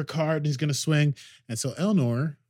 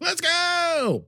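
high, loud call to go; excited shout; 5 dB louder than usual. Retold or imitated. Picard urging escape to ship, excited shout. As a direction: yell it, eager to leave.

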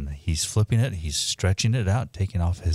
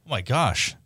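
delighted opening a present; 8 semitones higher than usual. Real, not acted.

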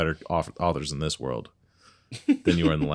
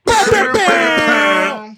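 loud shout; 11 dB louder, much slower than usual. Acted. Player's intro shout, character voice.

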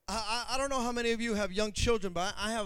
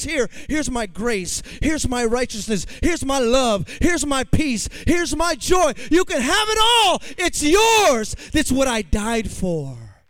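louder, soaring voice of God offering gifts; building joyful proclamation; 8 dB louder, slower than usual. Acted. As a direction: build from gentle to a jubilant cry. Voicing God's gift, rising.

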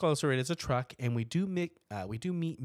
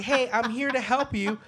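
high, loud sing-song fake helper; mock friendly stranger; 12 semitones higher, 8 dB louder than usual. Friend pulling up to offer help, high mock-sweet voice. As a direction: put on a sugary helper voice. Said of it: "were like".

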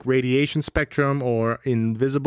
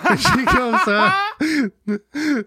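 high laugh-broken words; 11 semitones higher, much slower than usual. Real, not acted. Laughing while retelling.

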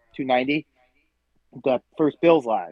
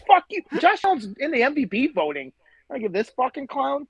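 angry outburst; 11 semitones higher than usual. Real, not acted. Host yells at dumb radio callers, real angry outburst. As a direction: blow up at the radio, real fury.

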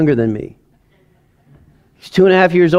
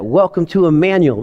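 ordinary talk, nothing marked

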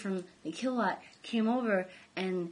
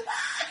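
laughing scream. Retold or imitated. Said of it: "and I'd be like".

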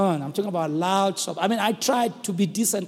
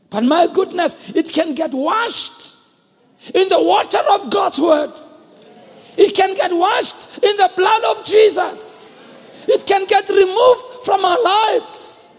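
slow, much higher and louder shouted preaching; full-force preaching; 10 semitones higher, 9 dB louder, slower than usual. Acted. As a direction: hammer it home to the congregation, slowly. Preacher builds to a climax.

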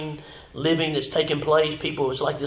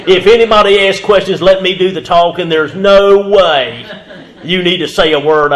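loud, higher-pitched insistent warning; emphatic retold warning; 4 semitones higher, 14 dB louder than usual. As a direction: lay down the rule loudly, dragging out "no way".

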